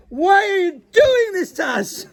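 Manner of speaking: imitating a mortified child; mock anguished kid's whine; desperate child. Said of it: "one of the children said".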